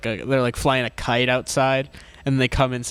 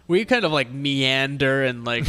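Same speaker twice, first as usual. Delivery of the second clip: normal talking voice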